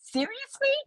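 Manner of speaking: high, offended disbelief; mock indignant. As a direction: play the offended woman. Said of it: "So she goes".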